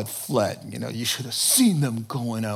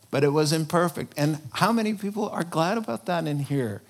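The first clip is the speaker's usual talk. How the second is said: ordinary talk, nothing marked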